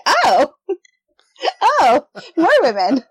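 high, drawn-out "oh" of dawning realization; retold surprised realization. Author retelling her reaction to Bill's assignment, surprised realization.

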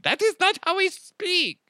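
mock Swiss accent, prim and very high; offended Swiss voice. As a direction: play an offended Swiss listener. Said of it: "All you Swiss people are like".

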